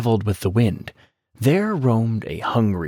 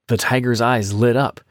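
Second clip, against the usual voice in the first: plain reading voice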